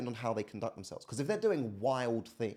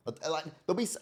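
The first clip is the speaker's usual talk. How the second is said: normal talking voice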